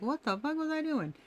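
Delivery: high-pitched mock bewilderment; imagined shocked realization. Imagined shocked look back at oneself.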